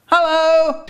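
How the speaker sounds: very high, piping hello; chirpy inspector greeting. A narrator's reading. Inspector walks into the cafe, high piping hello. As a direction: sing out a high-pitched greeting. Inspector makes his entrance.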